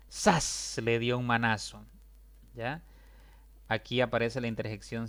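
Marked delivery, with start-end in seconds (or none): 0.1-0.8 "Sas" hissed high, unvoiced; quick slap sound. Shown as a demonstration. Teacher acts the slap "Sas" as a sharp hiss. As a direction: snap out "Sas" like a smack. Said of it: "Veamos otro ejemplo".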